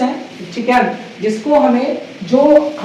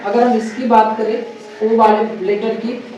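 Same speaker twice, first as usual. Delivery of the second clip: ordinary talk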